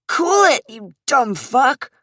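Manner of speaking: high, loud snarl of a thug; angry thug shout. Acted. Tall thug yells at struggling Zach, angry shout. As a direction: bark it at the struggling man.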